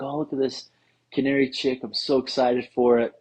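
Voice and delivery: mock excited. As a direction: play the proud breeder showing off the chick. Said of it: "he was really excited to show me".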